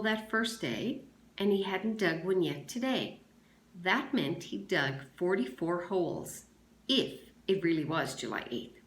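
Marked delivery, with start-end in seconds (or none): none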